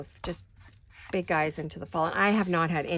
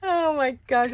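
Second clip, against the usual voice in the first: laughing; 7 semitones higher, 6 dB louder, slower than usual. Real, not acted. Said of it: "rolling around uncontrollably on the floor".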